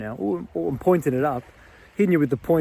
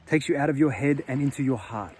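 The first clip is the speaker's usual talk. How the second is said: usual speaking voice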